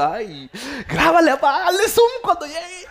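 high, loud, stretched-out urging; urgent excitement. Acted. Son telling dad to film mom, loud excitement. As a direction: egg dad on with gleeful mock urgency.

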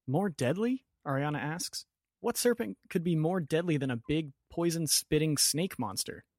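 mildly incredulous worry; alarmed question. Acted.

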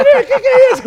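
high, loud startled cry with a laugh; laughing shock. Acted. Son cornered by his siblings onstage, high, loud laughing shock. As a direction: yelp it in mock alarm.

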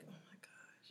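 soft, unvoiced sigh of pleasure; breathy whisper. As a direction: whisper it like it feels good. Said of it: "I would be like".

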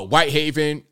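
normal talking voice

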